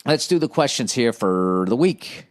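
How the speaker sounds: usual speaking voice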